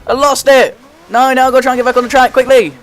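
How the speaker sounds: loud, high cry of dismay as the car spins; panicked high shout at the wheel. Real, not acted. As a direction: yell it out in real frustration. Spins out during the race.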